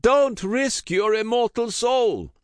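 very high, loud pleading wail. A narrator's reading. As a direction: beg him not to go. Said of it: "wailed Juan Gonzalez".

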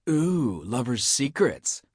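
sing-song mock over his whispering; cooing tease. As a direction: tease him like a schoolyard gossip. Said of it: "cooed Tony".

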